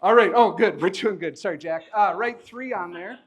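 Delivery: normal talking voice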